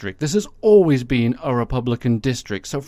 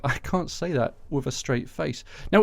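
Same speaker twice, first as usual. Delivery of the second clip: ordinary delivery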